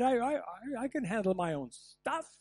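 stammered, self-reliant protest; proud bravado. Acted. Man stuck in the cellar refusing help, proudly defensive. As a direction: insist on coping alone. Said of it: "because of my pride".

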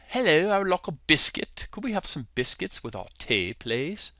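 quick, softening amateur British accent. Shown as a demonstration. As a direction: put on a polite British request. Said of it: "give you my British accent".

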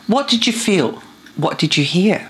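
usual speaking voice

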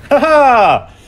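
high, loud mocking yell; gloating taunt. Real, not acted. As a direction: crow over the kill. Taunting after a kill.

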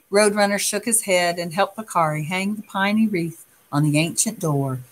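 ordinary delivery, nothing marked

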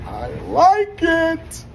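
very high, loud and slow; drawn-out squeal. Real, not acted. Rapper pleased with the video shoot, drawn-out high squeal. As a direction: stretch it out in a delighted squeal.

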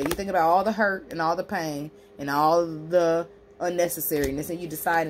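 normal talking voice

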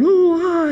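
very high ooh and ah of wonder; crowd marvelling. Retold or imitated.

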